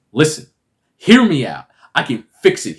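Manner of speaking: lightly acted appeal; mock pleading. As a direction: plead your case in a put-on urgent voice.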